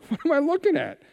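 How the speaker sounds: baffled disbelief. Retold or imitated. Disciples baffled by Jesus.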